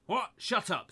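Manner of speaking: high, loud furious shout; angry scream. A narrator's reading. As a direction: scream him down. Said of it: "screamed ... in an angry voice".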